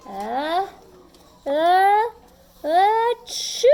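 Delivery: building sneeze. Acted. The bear's pepper sneeze building to a burst. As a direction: wind up a big sneeze.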